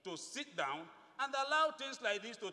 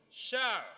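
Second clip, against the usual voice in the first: shall hit higher and louder, falling; emphasis on shall; 4 semitones higher, 6 dB louder than usual. Shown as a demonstration. Quoting the law to colleagues, shall punched. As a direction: punch the word shall hard. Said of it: "again emphasis on shall".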